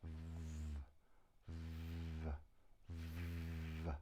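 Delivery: V held as a long "vvvv" with teeth on lip; long buzzed v. Shown as a demonstration. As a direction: breathe out through a sustained, vibrating V. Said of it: "put your teeth on your bottom lip".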